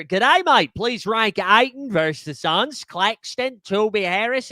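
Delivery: a shaky put-on Australian accent that slips as it goes; hammed-up Aussie greeting, the Australian accent fading out. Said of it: "you could see the accent fall off in real time".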